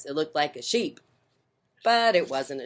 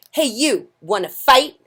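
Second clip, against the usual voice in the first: high, loud cocky taunt; grouchy ladybug challenge; 9 semitones higher, 11 dB louder than usual. Acted. Grouchy ladybug challenging a yellow jacket, high and loud. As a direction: call out a fight, brash.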